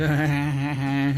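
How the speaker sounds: mock cackling laugh; put-on burst of laughter; imitated laughing fit. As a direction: act out laughing yourself silly. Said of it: "piss yourself laughing".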